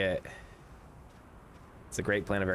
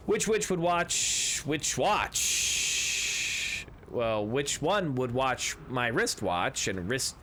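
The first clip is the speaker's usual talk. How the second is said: tongue twister; slower than usual. Pretend. Reciting a tongue twister riddle.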